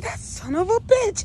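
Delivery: high, loud indignant cry; comic indignation on a friend's behalf; mock outrage. Acted outrage at the drunk driver.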